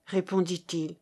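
plain reading voice

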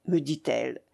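plain reading voice

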